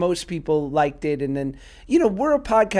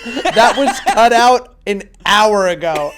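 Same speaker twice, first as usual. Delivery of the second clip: loud, higher, slow quote through laughter; retold deadpan editor's reply; 4 semitones higher, 10 dB louder, slower than usual. Host quoting the editor's comeback, laughing. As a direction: crack up while quoting the editor. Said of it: "And she goes".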